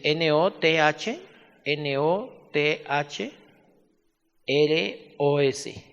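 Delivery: each letter slowly and loudly spelled for dictation. Shown as a demonstration.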